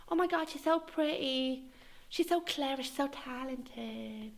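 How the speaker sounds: high, dreamy gushing voice; mock the lovestruck boyfriend; high swooning parody of the ex, fading away. Mocking boyfriend gushing about Dominique.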